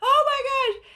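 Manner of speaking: very high mock-tearful fangirl squeal; hammed-up overwhelmed squeal, imitating a starstruck mother; imitated crying mom. Mom seeing her idol, imitated tearful squeal. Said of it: "she started crying, and she was like".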